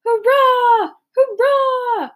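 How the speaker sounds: loud, high shouted cheer; a roaring cry of joy; soldiers cheering. Acted. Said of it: "the soldiers shouted".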